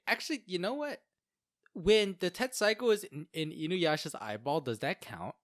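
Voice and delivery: usual speaking voice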